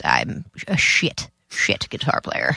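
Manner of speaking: low, slow self-mocking growl; self-deprecating. Real, not acted. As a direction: grumble it down low.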